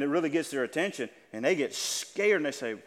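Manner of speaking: usual speaking voice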